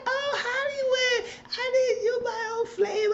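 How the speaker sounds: high, slow, sugary mocking voice. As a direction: ham up the old girlfriend cooing at him. Mocking the old girlfriend Dot.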